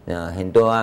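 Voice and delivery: ordinary talk, nothing marked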